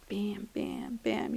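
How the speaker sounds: soft, underpowered verbal punches; weak bam. Shown as a demonstration. Mimicking a nagging inner harangue, weakly. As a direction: let each bam land feebly. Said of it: "my bam wasn't strong enough".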